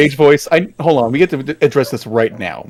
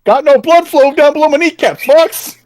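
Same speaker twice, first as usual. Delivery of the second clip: very high cartoon radio yell; Star Fox pilot voice; 4 dB louder than usual. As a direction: shout the classic barrel roll line. Star Fox quotes, hammed up.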